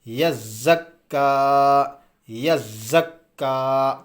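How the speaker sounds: یزکا very slow, doubled consonants pressed, last vowel held long; firm doubled-letter reading. Shown as a demonstration. Teacher modelling tashdeed for students, firm drawn-out یزکا. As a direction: recite یزکا firmly and stretch the ending. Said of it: "تھوڑا سا سختی سے پڑھنا ہے".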